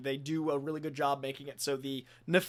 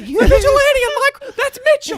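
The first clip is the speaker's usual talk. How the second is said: loud, squeaky high cop; a shrill little cop; 14 dB louder than usual. Retold or imitated.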